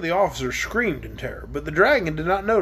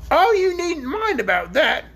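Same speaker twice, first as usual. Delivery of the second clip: high, slow and lofty; haughty queen; 9 semitones higher, 3 dB louder, slower than usual. Acted. Queen dismissing the dragon's help, haughty and slow. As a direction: look down your nose at the dragon. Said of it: "in her most haughty manner".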